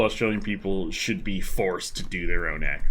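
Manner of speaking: normal talking voice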